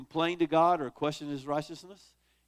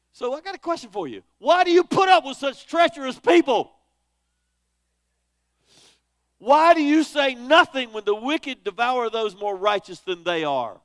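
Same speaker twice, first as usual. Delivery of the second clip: much higher and louder, demanding answers; indignant complaint; 8 semitones higher, 12 dB louder than usual. Retold or imitated. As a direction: challenge God, outraged at injustice. Voicing Habakkuk's complaint to God.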